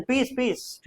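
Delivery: high-pitched call for peace. Retold or imitated. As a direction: raise the voice high to hush the crowd. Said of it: "second citizen".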